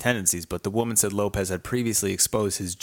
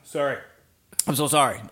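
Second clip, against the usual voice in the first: choking on a drink; 5 semitones higher, 4 dB louder, faster than usual. Real, not acted. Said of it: "It went down the wrong pipe".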